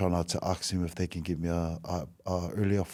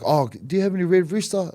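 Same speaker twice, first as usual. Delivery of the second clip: higher, put-on hopeful voice; hopeful ask; 10 semitones higher, 4 dB louder than usual. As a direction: ask for the other chain half-joking. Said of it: "I was like".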